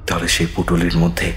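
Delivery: plain reading voice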